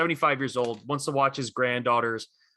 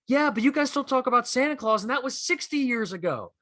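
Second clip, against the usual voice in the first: much higher, heated comeback voice; 12 semitones higher than usual. Retold or imitated. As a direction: fling the challenge back at him, pitched way up. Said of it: "he's like".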